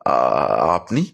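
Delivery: hesitant, stammered question with a slight tremble; trembling voice. A narrator's reading. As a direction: falter on the first sound, as if startled. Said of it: "কাঁপা কাঁপা গলায় বললাম". (কাঁপা কাঁপা গলায়, থেমে থেমে)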